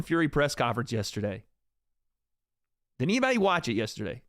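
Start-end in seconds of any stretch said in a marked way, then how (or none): none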